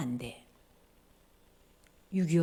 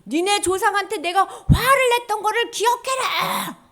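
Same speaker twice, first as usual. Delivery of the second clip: high, loud acted proclamation; God's stern command; 7 semitones higher, 7 dB louder than usual. God telling the people to remember, loud command. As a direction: thunder it out as an order. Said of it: "하나님이 기억하라는 거야".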